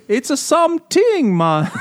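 high, slow mock Jamaican accent, abandoned; a brief, high Jamaican accent try. Joking about radio minstrels, high Jamaican accent attempt. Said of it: "if they're Jamaican".

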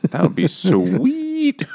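high, slow, dreamy delight; delighted pig voice. Retold or imitated. Pigs just realised the idea, high slow delight. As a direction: savour the idea with a high giddy voice.

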